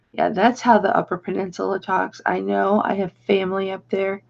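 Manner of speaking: ordinary talk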